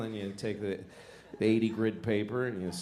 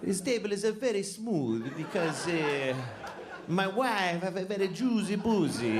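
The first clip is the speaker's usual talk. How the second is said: higher, hammed-up Italian accent; Italian accent bragging about his table; Italian craftsman; 8 semitones higher than usual. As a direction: play a proud old Italian table maker. Italian furniture maker character.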